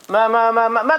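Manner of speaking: stuttering start, then a brusque order; flustered stammer. Acted. Hajj dodging his disciple's question about the sheikh, flustered stammering. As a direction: stumble on the first word, then dodge. Caught out, stammers and changes subject.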